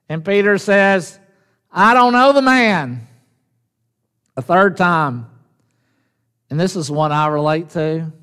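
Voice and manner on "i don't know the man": higher-pitched, emphatic denial; insistent denial. Acted. Peter to the second servant girl, insistent denial. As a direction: deny it firmly, pitch raised.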